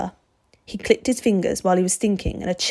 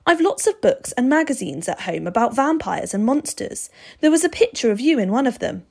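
put-on higher-pitched boy's voice, explaining; eager boy Steve, insistent; 5 semitones higher, 4 dB louder than usual. A narrator's reading. Boy telling the vampire how he recognised him, insistent.

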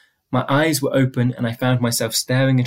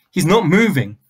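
raised, high-pitched scream; 5 semitones higher, 5 dB louder than usual. A narrator's reading. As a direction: mum screaming, loud and high. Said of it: "she screamed".

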